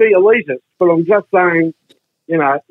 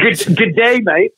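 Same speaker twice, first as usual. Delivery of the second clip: higher, stumbling fake-Aussie "good day"; American imitating Australian; 3 semitones higher, slower than usual. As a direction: botch the Australian greeting like an American. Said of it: "Americans find out you're Australians".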